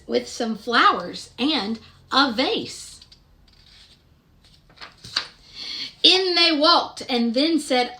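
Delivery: gentle, sing-song picture-book narration; storybook reading. Acted. Reading Madeline aloud to young children, sing-song storytelling. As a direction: read the rhyme aloud to little listeners.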